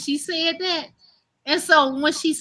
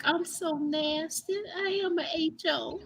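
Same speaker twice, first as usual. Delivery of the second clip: softly hurt; 3 semitones higher, 11 dB quieter than usual. Retold or imitated. Retelling her hurt reaction.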